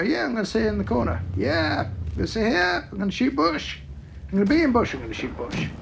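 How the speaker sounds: high, fast, smug mock camper voice; mock lazy camper. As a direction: play the lazy tank camper who won't budge. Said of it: "you just go".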